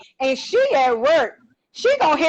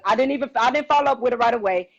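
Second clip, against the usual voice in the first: usual speaking voice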